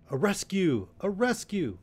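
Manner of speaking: high-pitched rallying calls; crying out. A narrator's reading. Some in the fray, crying for rescue. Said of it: "and some cried".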